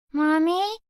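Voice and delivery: put-on high, timid little girl asking; little girl voice, unsure; small child. A narrator's reading. Child voiced in thriller story.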